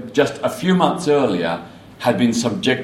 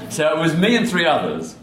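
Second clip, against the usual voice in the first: high laugh then laughing words; amused laugh; 6 semitones higher than usual. Real, not acted. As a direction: laugh at how few they were. Laughs at tiny group.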